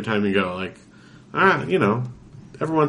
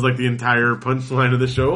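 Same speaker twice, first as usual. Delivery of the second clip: normal talking voice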